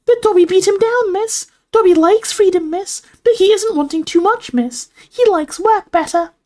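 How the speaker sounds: very high elf squeak; earnest house elf. A narrator's reading. Dobby reports the first offer, squeaky elf. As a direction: pipe it like a little elf.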